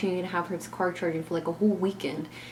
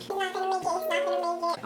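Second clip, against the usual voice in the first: much higher put-on mom voice; mimicking mom; 12 semitones higher, faster than usual. Narrator imitates her mom asking the driver, high-pitched.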